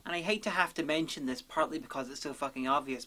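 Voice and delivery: ordinary talk, nothing marked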